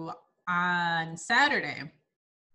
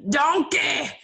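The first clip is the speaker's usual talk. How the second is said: loud, higher Shrek-style holler; Shrek's yell; 6 semitones higher, 8 dB louder than usual. Acted. Joking onion breath is Shrek-bad, mock yell. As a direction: yell it out as if calling Donkey. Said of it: "I could literally be yelling".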